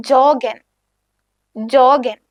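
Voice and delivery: "ജോഗൻ" with an English j, jo-gen; no ya sound. Shown as a demonstration. Teacher showing a loanword exception, "ജോഗൻ" with English j. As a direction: begin "ജോഗൻ" on a hard j as in jog, not y. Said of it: "യാ sound use ചെയ്യത്തില്ല, ജോഗൻ എന്ന് തന്നെയാണ്".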